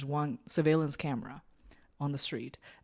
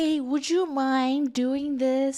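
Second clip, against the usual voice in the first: higher, slow, over-polite asking; imitated polite request; 7 semitones higher, 5 dB louder, slower than usual. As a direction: draw out a careful, mock-polite request. Acts out her polite request to employee.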